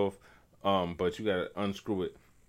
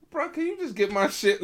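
exasperated; 11 semitones higher than usual. Retold or imitated. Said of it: "I was like".

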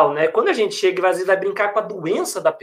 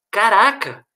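usual speaking voice

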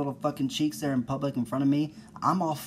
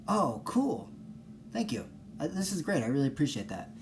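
mild polite thanks; 3 semitones higher, 4 dB quieter than usual. Pretend. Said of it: "and just go".

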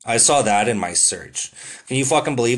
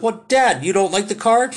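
much higher, mock-innocent rising question; cheeky son voice; 8 semitones higher than usual. Tom Green pulling up in the car, mock-innocent and high. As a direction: play dumb and innocent to the angry dad.

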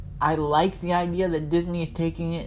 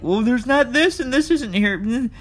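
whiny complaint; 10 semitones higher, 3 dB louder than usual. Retold or imitated. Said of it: "saw it were like".

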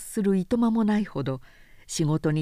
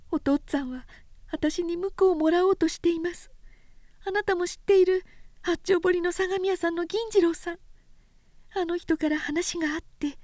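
put-on a shy young woman welcoming him home; young woman's greeting; 10 semitones higher than usual. A narrator's reading. Oyuki greeting Sōshichi at home, gentle and high.

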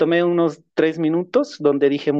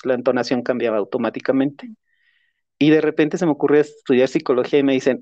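usual speaking voice

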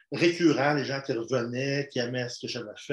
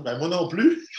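normal talking voice

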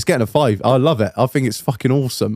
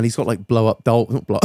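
ordinary talk, nothing marked

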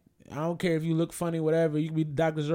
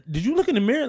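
louder, higher sassy clapback; tough comeback; 8 semitones higher, 6 dB louder, faster than usual. Retold or imitated. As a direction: snap back at her with attitude. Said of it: "tough and was like".

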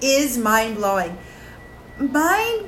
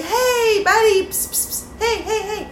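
calling out; 10 semitones higher than usual. Shown as a demonstration.

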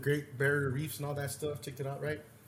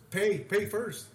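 louder, higher curt order; imitated bartender demand; 6 semitones higher, 5 dB louder, much slower than usual. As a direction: insist on payment, raised voice. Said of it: "And he goes like this".

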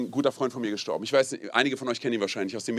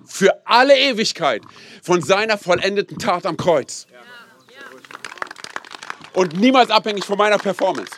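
loud, high, slow proclamation; preaching climax; 4 semitones higher, 5 dB louder, slower than usual. Real, not acted. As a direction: build it into a ringing declaration. (laute, langsame Predigt-Steigerung)

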